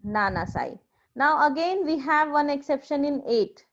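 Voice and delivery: ordinary talk, nothing marked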